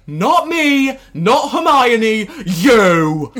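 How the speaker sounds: high, loud, slow Ron voice; Ron impression. Co-host imitates Ron from the chess scene, high and loud. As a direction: plead it like a boy hero. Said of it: "Ron's like".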